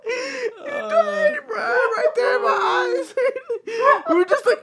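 high, slow laughing near tears; laughing hard. Real, not acted. Teller laughs hard as the skeleton kills the horse. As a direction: laugh till it almost sounds like crying.

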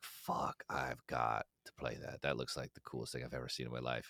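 longing for a game after a commercial; hushed longing. Retold or imitated. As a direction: breathe it like a secret craving. Inner craving for game.

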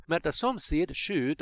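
usual speaking voice

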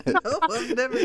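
loud, high words through laughter; real laughing. Real, not acted. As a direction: crack up while delivering the quote. Cracks up retelling his reply.

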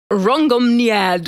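Rongomniad said slowly, syllable by syllable; careful pronunciation. Shown as a demonstration. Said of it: "gonna be tough to pronounce".

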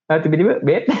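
usual speaking voice

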